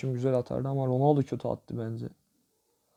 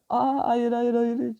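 dismayed protest; 10 semitones higher, slower than usual. Real, not acted. Watching a dribble go wrong.

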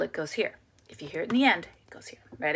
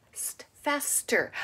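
ordinary talk, nothing marked

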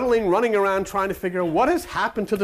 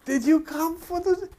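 a hammed-up woman calling to God; high Sarah voice; 11 semitones higher, slower than usual. Sarah calls out to God, in a high hammed-up voice.

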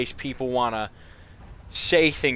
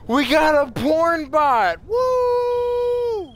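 excited shout; 9 dB louder, much slower than usual. Real, not acted. Excited yell at porn bot.

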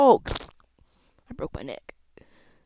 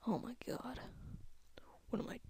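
whisper; 4 semitones higher, 14 dB quieter, faster than usual. Real, not acted. Dismay at failing.

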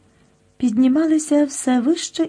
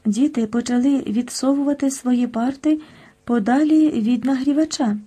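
plain reading voice

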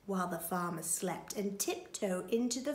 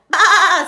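long, very loud bleat; sheep baa; 8 semitones higher, 18 dB louder than usual. A narrator's reading. Sheep hears of the plot, loud bleating. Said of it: "said the sheep".

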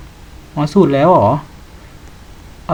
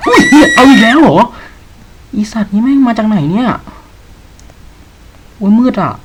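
much higher, loud startled scream; frightened scream; 9 semitones higher, 7 dB louder than usual. Real, not acted. Streamer hit by another jump scare, screaming in shock. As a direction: scream in real shock, then keep yelling.

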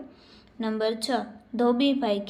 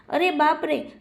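higher-pitched "oh dear"; acted startled exclamation; 5 semitones higher, 4 dB louder than usual. A character finds the pocket very hot, acted alarm.

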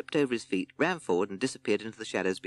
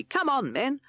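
high, bright cartoon captain; rally the troops in a high voice; Captain Beaky voice; 4 dB louder, slower than usual.

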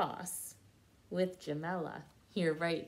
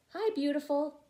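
friendly call; 6 semitones higher, 3 dB louder than usual. A narrator's reading. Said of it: "called old Greasy Hands".

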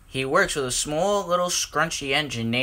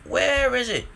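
anxious; 10 semitones higher than usual. A narrator's reading. Whiff searching for lost pipe.

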